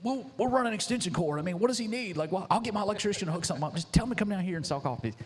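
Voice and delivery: high-pitched, offhand guy brushing off the favor; high, hurried mock voice; Ty imitation. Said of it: "you know how Ty is".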